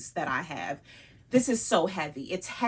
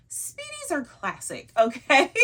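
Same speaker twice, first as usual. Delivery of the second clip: much higher, sing-song insistence; voice rising; 7 semitones higher, 6 dB louder than usual. Shown as a demonstration. Vlogger insisting to viewers, much higher voice. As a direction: lift the whole line up high. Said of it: "you can hear in the influx in my voice".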